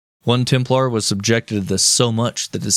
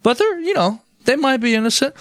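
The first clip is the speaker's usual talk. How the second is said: very high-pitched mock voice; mock concession. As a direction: concede it in a silly, squeaky-high voice. Pope's feigned concession, acted.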